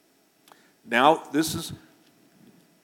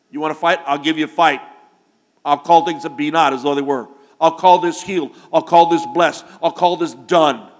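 loud, combative preacher's declaration; defiant shout, punching out each promise; John Wayne fighting spirit; 10 dB louder than usual. Acted. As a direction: throw down a challenge at full volume. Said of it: "My John Wayne's coming out of me".

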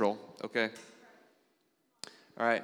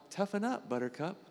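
quoting a pastor; 8 semitones higher, 3 dB quieter than usual. Retold or imitated. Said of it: "in the famous words of Pastor Charles Neiman".

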